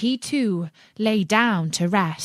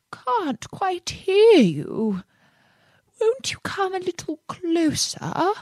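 feeble fox voice; 11 semitones higher than usual. A narrator's reading. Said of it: "said the fox in a feeble voice".

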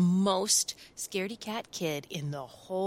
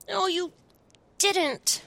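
put-on high child voice, louder snap; a little boy snapping back; snapped; 11 semitones higher, 4 dB louder than usual. Sam snaps at the monster, high child voice. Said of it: "snapped Sam".

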